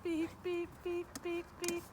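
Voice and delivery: high, quiet beeping cue to the dog. Shown as a demonstration. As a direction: chirp the beeps softly and high, like a signal. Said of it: "when I beep, she backs up".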